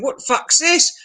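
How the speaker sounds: high, startled mock outrage; shocked locals. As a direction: gasp it like a scandalised local.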